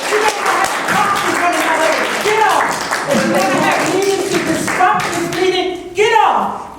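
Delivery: loud, high "get off" yelled at them; furious shout. Real, not acted. Resident shouting at feuding council members to get off, furious. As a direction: yell them off the dais.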